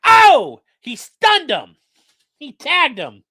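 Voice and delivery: imitating Joe Rogan calling a fight. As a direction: hype the knee like a UFC commentator calling a hit. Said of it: "it's like Joe Rogan".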